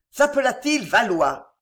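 ordinary delivery

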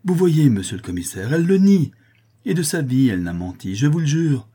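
loud, high, swooping insistence; triumphant vouching. A narrator's reading. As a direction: swear to it eagerly. Relieved father vouches for his daughter.

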